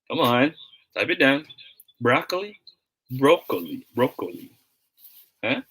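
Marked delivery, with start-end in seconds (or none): 2.0-2.6 broccoli as BRAHK-lee, high and rising; open O in broccoli. Shown as a demonstration. Teacher urging viewers to answer, broccoli as BRAHK-lee. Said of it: "how should we pronounce this word". 3.1-4.5 broccoli as BROH-kuh-lee, falling; long O in broccoli. Shown as a demonstration. Teacher urging viewers to answer, broccoli as BROH-kuh-lee. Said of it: "how should we pronounce this word".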